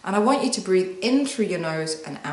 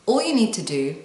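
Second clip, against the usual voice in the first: ordinary talk, nothing marked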